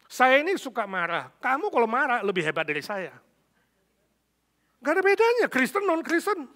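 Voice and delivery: loud, high angry outburst as the husband; suami marah. Retold or imitated. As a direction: act out a husband's rant building to a yell. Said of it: "Suaminya marah, mengatakan". (suami marah berteriak, nada melengking)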